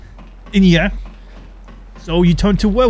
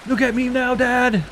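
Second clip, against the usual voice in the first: put-on higher, childish show-off call; mock boast to dad; 7 semitones higher than usual. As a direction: shout out to an imaginary dad, playfully.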